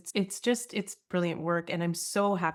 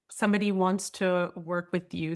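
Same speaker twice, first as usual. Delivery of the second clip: usual speaking voice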